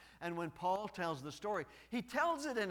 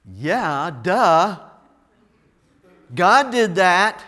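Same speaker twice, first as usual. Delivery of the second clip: mock dismissive; 2 semitones higher, 21 dB louder, much slower than usual.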